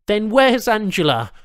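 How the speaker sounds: high, loud, pressing question; patient pleading for Angela; urgent demand. A narrator's reading. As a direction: demand a familiar face. Charles demands again.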